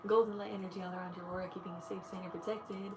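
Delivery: soft, quiet meditation voice guiding through each chakra; guided meditation. Acted.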